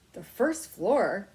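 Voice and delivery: put-on a boy amazed, slow and loud; disbelief. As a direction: stretch it out in disbelief. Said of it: "Keith could not believe it".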